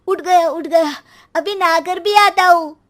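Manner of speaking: put-on a kid jumping out of bed in a fluster; panicked boy. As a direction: son jumping up to dodge mother's threat, flustered. Son leaps up in panic.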